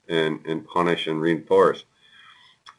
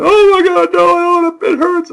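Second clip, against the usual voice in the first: put-on very high, loud child wail; wailing toddler; 10 dB louder than usual. Father imitating son refusing more potty time, high wail. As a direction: cry out like a panicking little boy. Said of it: "he went right back to".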